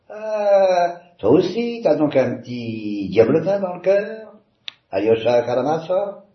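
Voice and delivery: teasing. Acted. Ivan teases Alyosha delightedly.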